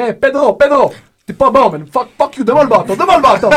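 high, loud mock-angry old coach; hammed-up old-timer's yelling; heckling old man. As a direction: holler at the player like a cranky veteran. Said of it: "des vieux de soixante et seize ans qui disent".

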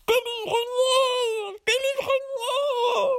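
high, slow, crying little man; tiny pleading voice. Acted. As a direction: plead high and thin from inside the bottle. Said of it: "criait-il d'une voix à peine audible".

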